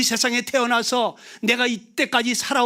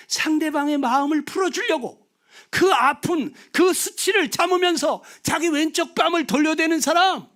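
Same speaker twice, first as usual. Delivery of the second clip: higher and louder, building to 사람 with a falling tone; preaching emphasis; 6 semitones higher, 4 dB louder than usual. Shown as a demonstration. Preacher to the congregation, a loud proclaiming peak. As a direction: proclaim 돌려대는 사람 with full force. Whispered then shouted cheek-turning phrase.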